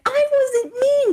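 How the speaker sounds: very high, drawn-out denial; playful high-pitched defensive whine; mock-innocent protest. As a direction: squeak out your innocence slowly. Protesting her innocence to listener.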